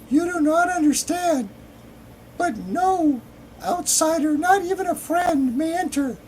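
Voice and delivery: very high, loud falsetto maiden; man badly faking a woman's high voice; failed feminine voice. A narrator's reading. Forbidding a stranger from entering the temple, in failed feminine falsetto. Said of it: "like a sixty-two-year-old man trying to sound like a woman and failing".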